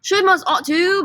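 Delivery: high, emphatic British accent. Shown as a demonstration. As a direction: put on a British accent, pitched well up. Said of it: "say it with a British accent".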